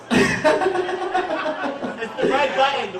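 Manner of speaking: high breathy laugh; a long amused laugh. Real, not acted. Host laughing.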